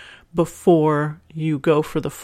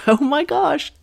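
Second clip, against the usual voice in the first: delighted laugh; 10 semitones higher, 3 dB louder than usual. Real, not acted. Host reacts to happy email.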